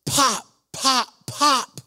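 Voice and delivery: high, soft, separate pops; imitated popping. Preacher imitating what everybody could hear, high little pops. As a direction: mimic joints popping one by one. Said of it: "out loud, everybody could hear it".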